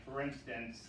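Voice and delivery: ordinary talk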